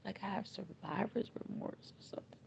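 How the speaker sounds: soft broken whisper of guilt; a whispered confession of survivor's remorse. Real, not acted. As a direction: breathe the words out.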